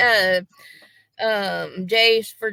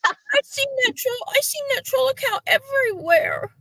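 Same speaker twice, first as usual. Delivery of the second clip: very high, mocking squeaky girl voice; high mocking squeal, mimicking the rival's troll complaint; mocking whiny girl. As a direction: mimic her as a shrill airhead.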